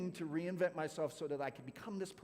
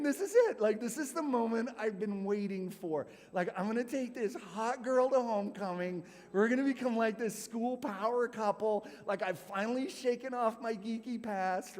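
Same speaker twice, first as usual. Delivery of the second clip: giddy inner voice; 6 semitones higher, 5 dB louder than usual. Retold or imitated. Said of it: "And I'm thinking".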